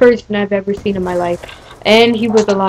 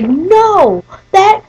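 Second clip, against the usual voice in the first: high, drawn-out mock protest; protesting kid; 10 semitones higher, much slower than usual. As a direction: imitate an indignant boy, slow and high. Mocking the boy's stubborn protest.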